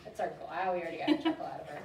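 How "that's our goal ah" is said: usual speaking voice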